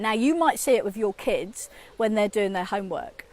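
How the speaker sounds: ordinary talk, nothing marked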